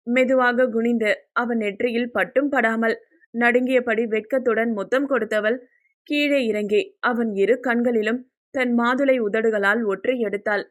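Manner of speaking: ordinary delivery, nothing marked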